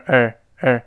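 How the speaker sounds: OUR reduced to a short "er"; weak form of our. Shown as a demonstration. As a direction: say "our" as a light "er". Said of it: "也变成a".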